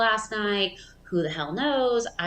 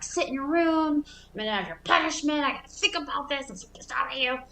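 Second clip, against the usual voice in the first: higher, fast scolding; imitating pissed mom; 8 semitones higher, faster than usual. Mom fuming at the kids, sending them to their rooms. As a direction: rattle off the punishment threat. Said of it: "She's pissed".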